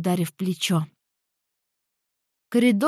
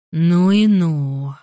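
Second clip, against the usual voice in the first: slow, drawn-out, louder ну и ну; drawled; 3 semitones lower, 6 dB louder, much slower than usual. Acted. Jeremy marvels at Samantha's power, slow drawl. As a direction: stretch the words out slowly and loudly. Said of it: "протянул Джереми".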